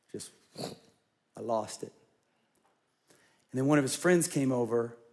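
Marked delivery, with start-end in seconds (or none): 0.1-1.9 choked up. Real, not acted. Tearful memory of son.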